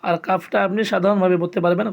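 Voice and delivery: usual speaking voice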